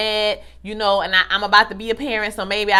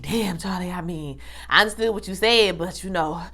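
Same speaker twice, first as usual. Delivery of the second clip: hurt quiet aside; 4 semitones lower, 6 dB quieter than usual. Retold or imitated. Vlogger's own hurt reaction.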